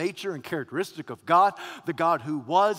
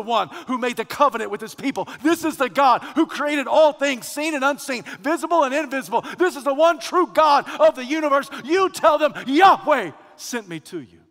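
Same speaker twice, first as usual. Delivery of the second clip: louder, higher sermon climax; preaching at full force; 8 semitones higher, 11 dB louder than usual. Acted. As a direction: build it up to a shout.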